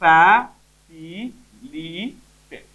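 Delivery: ଫ୍ୟାସିଲିଟେଟ୍ stretched out very slowly; very slow word. Shown as a demonstration. Teacher to students, ଫ୍ୟାସିଲିଟେଟ୍ said very slowly. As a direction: draw out ଫ୍ୟାସିଲିଟେଟ୍ syllable by syllable. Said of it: "ଏଠି ଦେଖ".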